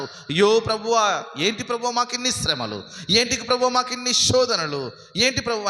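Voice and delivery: high pleading wail to the Lord; preacher voicing a people's anguished complaint; acted lament. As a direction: cry out each question on a high, falling tune.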